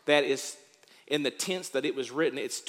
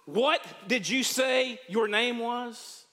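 slow, raised demand from the throne; king's demanding shout; 7 semitones higher, slower than usual. Retold or imitated. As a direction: bark the question at the soldier. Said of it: "He says".

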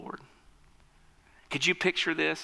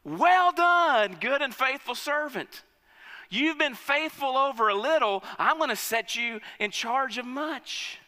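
master's praise; 7 semitones higher than usual. Acted. King commending his faithful servant, booming praise. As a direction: proclaim it like a proud king. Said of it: "And his master says to him".

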